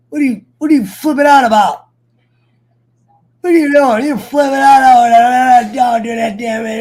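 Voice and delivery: loud, high, fast angry shouting; shouting at dog. Real, not acted. To his barking dog, angry shouting. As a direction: yell at the dog to stop.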